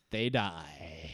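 very low, dramatic deadpan; ominous Juliet. Retold or imitated. Host voicing Juliet's chilling reply to Sun, very low. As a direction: drop the voice low for doom.

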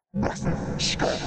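rasping demon whisper; unvoiced, menacing oni hiss. A narrator's reading. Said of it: "came the Oni Lord's voice".

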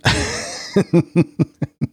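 a quick breathy chuckle; short laugh. Real, not acted. Host laughs at his own oldies joke, quick chuckle. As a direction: laugh it off lightly.

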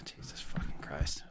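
exasperated whisper under breath; whispered curse. Real, not acted. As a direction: breathe it out unvoiced in disbelief. Exasperated aside after movie pick.

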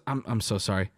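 low, subdued voicing of the wife; apologetic wife. Wife following the sick man in, low apology. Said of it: "His wife is there. She goes".

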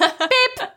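very high spoken bleep; imitated censor beep. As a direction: mimic a censor tone, high-pitched. Imitates a censor bleep over a cut.

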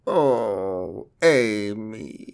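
drawn-out, droopy mole man moan; sad droopy voice. Acted. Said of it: "how you activate the voice?".

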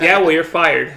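loud retold outburst; mock angry firing.

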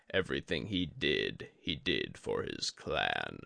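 a dying sensei, calm and heavy; Yori the old master. A narrator's reading. Yori urges Tadaka.

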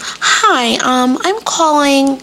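code-switched formal caller; phone-appointment voice. Shown as a demonstration. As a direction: put on your proper voice for the doctor's office. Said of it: "why do I have to do that voice".

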